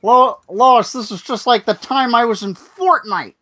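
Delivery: loud, high Peter Griffin voice; shouted cartoon dad voice.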